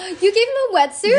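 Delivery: real laughing question; surprised through a laugh; laughing surprise. Real, not acted.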